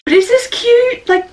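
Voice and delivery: high plea to viewers about her birthday outfit; high pleading question. Real, not acted. Asking viewers' opinion.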